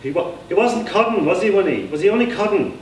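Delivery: high, worried stammer; Johnny fears the suitor was joking, anxious and high; anxious stammering simple man. Acted. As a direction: fret out loud with a catch.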